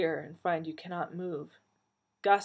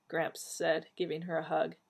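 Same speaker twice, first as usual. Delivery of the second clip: plain reading voice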